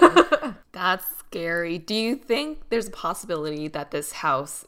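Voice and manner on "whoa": high loud whoa; surprised. Real, not acted. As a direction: gasp it out, startled. Reacting to the scary story.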